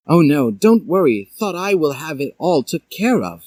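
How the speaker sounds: calm them down; reassuring teacher. A narrator's reading. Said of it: "she said".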